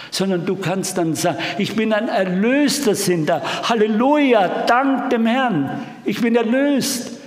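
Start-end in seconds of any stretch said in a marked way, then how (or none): 1.6-7.1 high, loud hallelujah cry; joyful redeemed cry. Pretend. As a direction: rejoice out loud like a freed man. Jubelnder Erlöster, vorgespielt. (freudig jubelnd, höher und lauter)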